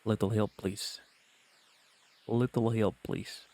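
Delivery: lightly voiced Russian woman, aiming deep; deep Russian accent. Streamer retrying the hunter's voice, deep Russian accent. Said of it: "Yeah, deep".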